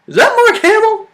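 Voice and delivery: high, astonished double take; surprised. Retold or imitated. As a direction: gasp it out in disbelief. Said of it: "I was like".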